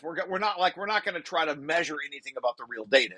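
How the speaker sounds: usual speaking voice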